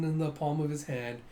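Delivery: usual speaking voice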